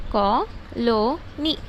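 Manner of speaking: Colony very slowly in three parts, कॉ, लो, नी; divided into syllables. Shown as a demonstration. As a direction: pause after each syllable of colony. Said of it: "तीन तरह से divide करते हैं". (Colony को तीन हिस्सों में धीरे-धीरे)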